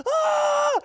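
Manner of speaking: high shouted ah; imitated scream. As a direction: yell it out in panic with the kid. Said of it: "I'm screaming with him".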